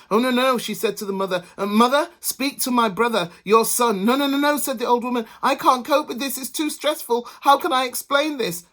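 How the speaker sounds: high, loud fluster; flustered panic. A narrator's reading. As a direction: panic and pass the blame. Women panic passing the blame.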